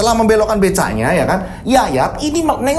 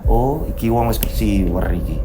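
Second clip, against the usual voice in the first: low-pitched knowing remark in Javanese; knowing guard's aside; 9 semitones lower than usual. Acted. Guard deciding the driver is bewitched, low knowing aside. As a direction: drop the pitch and size him up.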